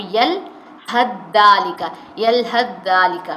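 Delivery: یلحظ ذالک slow, the ث swallowed into a doubled ذ; tha merged into dhal. Shown as a demonstration. Teacher showing students the merged یلحظ ذالک. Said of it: "زال پڑھے گا".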